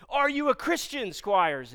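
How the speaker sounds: loud, high interrogation; barking commanding officer. Retold or imitated. Commanding officer demands to know trainee's faith, in a shout. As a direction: fire the question out like an officer.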